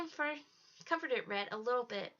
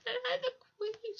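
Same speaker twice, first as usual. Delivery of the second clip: high, slow, tearful cry; a broken queen weeping the words; 12 semitones higher, 3 dB louder, slower than usual. A narrator's reading. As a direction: sob out her claim to the crown. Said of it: "she cried into Froggy's head".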